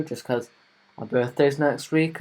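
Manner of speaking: ordinary talk, nothing marked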